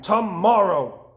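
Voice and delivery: slow, loud and high wail; cried out. Acted. Toad crying out from his bed at Frog, slow and loud. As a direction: stretch it out in protest. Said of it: "cried Toad".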